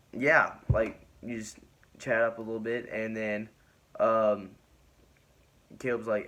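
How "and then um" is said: normal talking voice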